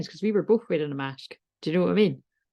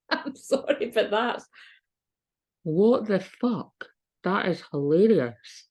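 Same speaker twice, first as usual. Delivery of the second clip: laughing; 4 semitones higher, slower than usual. Real, not acted. Laughing at rude subtitle.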